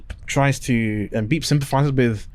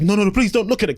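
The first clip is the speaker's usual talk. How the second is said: higher, hurried mock plea; frantic pleading; 6 semitones higher, faster than usual. Mother warns Donna off, frantic pleading. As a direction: beg someone to look away.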